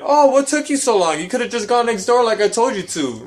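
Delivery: high, loud mocking nagging voice; high loud put-on voice of a scolding clerk; mocking the nail lady. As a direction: mimic her scolding, shrill and loud. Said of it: "the lady fucking tries to dog me out on it".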